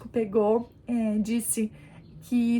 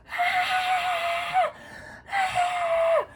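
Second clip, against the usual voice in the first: long high wailing ah; labour screams. Retold or imitated. Mother retelling her screams while giving birth, long and high. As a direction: wail through the push. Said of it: "Berrava de um jeito".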